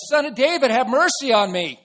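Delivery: very loud, high-pitched cry for help; pleading yell. Retold or imitated. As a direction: yell it out over the crowd. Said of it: "And he yelled".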